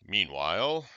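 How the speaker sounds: loud, low storyteller voice; narrator voice. Pretend. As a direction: announce it like a trailer narrator. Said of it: "my best narrator voice".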